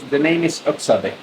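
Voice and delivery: usual speaking voice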